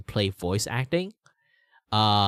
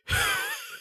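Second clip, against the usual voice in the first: put-on old man sigh. Shown as a demonstration. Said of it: "I'd have to be like this".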